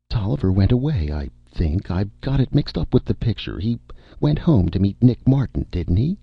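high, uncertain star voice; vague, dim film star. Acted.

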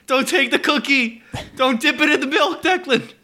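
high, pleading mock deathbed cry; dramatic deathbed plea. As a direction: beg a dying friend to stay. Mock death scene continues.

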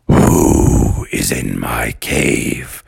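very low, slow, menacing monster; cave voice. Acted. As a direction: draw it out deep and spooky. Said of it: "And the voice said".

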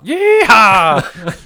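loud, high cowboy yeehaw; hammed-up Southern cowboy holler; country accent. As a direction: whoop it like a rodeo cowboy. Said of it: "We gotta do an accent also".

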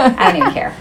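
usual speaking voice

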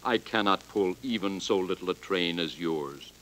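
put-on low, weary old engine voice fading away; tired old engine fading. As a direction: sigh it out as he chugs off into the distance. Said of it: "shook his cowcatcher sadly".

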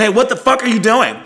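high, loud challenge to a thief; retold angry yell. Woman confronts the man at her car door, retold angry yell. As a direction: call out in outraged alarm, retelling it.

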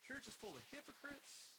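high, slow, smug voice of his younger self; mocking younger self. Preacher mocking his judgmental younger self, smugly. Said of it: "I was like".

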